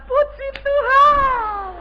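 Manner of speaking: high, drawn-out sugary praise; mock pious. Churchgoer greeting the minister, high mock-sweet praise. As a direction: sing it out sweetly and slowly.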